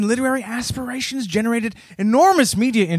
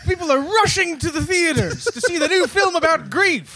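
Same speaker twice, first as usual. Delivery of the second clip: mock excitement; 8 semitones higher than usual. Sarcastic riff on film about grief.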